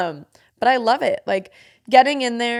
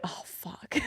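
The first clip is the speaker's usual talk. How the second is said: a breathless whispered oh fuck; exhausted whisper mid-breathwork; whispered curse; 16 dB quieter than usual. Retold or imitated. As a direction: whisper it under your breath. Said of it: "And I was like".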